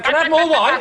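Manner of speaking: high-pitched comic mimicry; hammed-up demanding diner, pitched right up; Anne Robinson. TV presenter asking for wine at dinner, exaggerated mimicry. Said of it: "She was going like".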